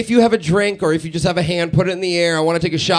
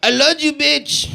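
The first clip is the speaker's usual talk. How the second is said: unromantic Spanish-speaker accent; 7 semitones higher, slower than usual. Shown as a demonstration. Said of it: "It is like".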